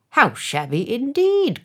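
high, loud fawning agreement; yes-men councillors crying out in agreement; eager echoing cry. A narrator's reading. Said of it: "cried the town councillors".

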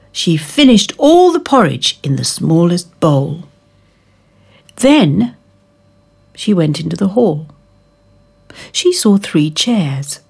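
ordinary delivery

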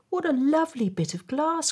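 put-on high, light child's delight; high-pitched wondering voice; little girl's cry. A narrator's reading. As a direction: exclaim it as a small girl. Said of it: "cried the little girl".

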